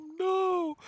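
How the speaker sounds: horrified shout. Retold or imitated. Guitarist sees his mom with the clown, shrieks high and loud. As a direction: scream it like a son catching his mom. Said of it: "he's like".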